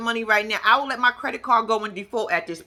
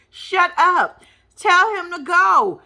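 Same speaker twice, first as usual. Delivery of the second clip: louder, higher command; bossy telling off; 7 semitones higher, 5 dB louder, much slower than usual. Pretend. Vlogger telling Eve off as if she were there, loud. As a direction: order her to let him go.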